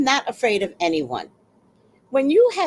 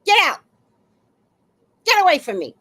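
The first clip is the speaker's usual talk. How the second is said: loud, higher-pitched command to leave; retold angry order; 7 semitones higher, 5 dB louder, faster than usual. Woman retelling how she threw a man out, angry raised voice. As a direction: sharply order someone away, voice raised. Retelling her confrontation, yelling at him.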